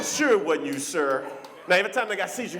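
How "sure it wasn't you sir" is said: low, doubting drawl; sarcastic. Pretend. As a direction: say it not believing him.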